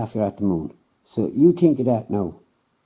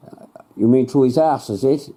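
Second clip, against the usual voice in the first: ordinary talk, nothing marked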